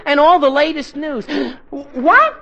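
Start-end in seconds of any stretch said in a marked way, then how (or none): 1.3-1.9 sharp gasp of shock; startled gasp. Acted. Archie hears the bulletin about himself, gasping in shock. 1.9-2.4 high, loud startled yelp; shocked. Acted. Archie hears the radio say he's missing, shocked.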